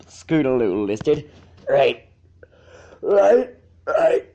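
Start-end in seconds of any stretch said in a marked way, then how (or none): 1.7-4.3 yawned. A narrator's reading. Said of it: "he yawned".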